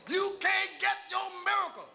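high, forceful shouted preaching; full-force preaching. Real, not acted. Preacher urging the congregation to push for their miracle, shouted preaching. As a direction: drive every phrase home with a shout.

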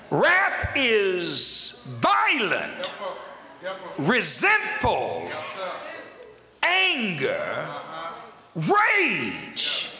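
very slow shouted emphasis on each word; forceful, deliberate. Shown as a demonstration. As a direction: punch "violent" and "rage", long pauses. Repeats definition with rising force.

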